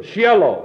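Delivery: Shiloh said as SHEE-eh-loh; Hebrew-style Shiloh, SHEE-eh-loh; Hebrew pronunciation. Shown as a demonstration. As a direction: give Shiloh an "ee-eh" in place of the long I. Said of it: "It's simply pronounced".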